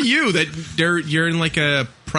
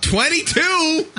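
high, drawn-out amazed echo; 9 semitones higher, much slower than usual. Real, not acted. Host reacting to caller's age, amazed. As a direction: repeat it slowly in real amazement.

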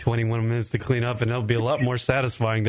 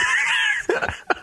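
high shrill burst of laughter; shrieking laugh. Real, not acted. Host laughing at his joke about Google, squealing laugh.

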